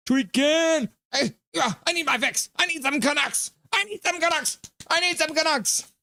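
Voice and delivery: high, jittery put-on voice, pleading; mock tweaking addict. Streamer voicing viewer who needs Canucks, mock craving. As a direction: play a desperate fan craving a fix.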